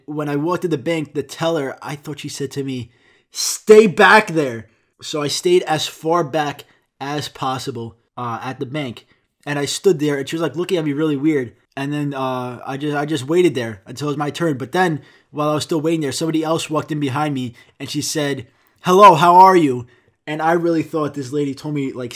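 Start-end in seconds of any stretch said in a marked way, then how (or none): 3.3-4.7 loud, drawn-out call across a room; slow, high, yelled warning; yelled order. Retold or imitated. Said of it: "shouldn't yell out a greeting". 18.8-19.9 loud hollered hello; mimicking a teller's aggressive shout; aggressively yelled greeting. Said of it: "she said it very aggressively".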